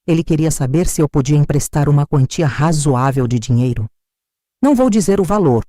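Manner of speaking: usual speaking voice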